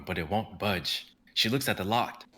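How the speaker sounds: ordinary talk, nothing marked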